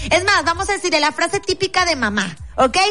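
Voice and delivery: ordinary delivery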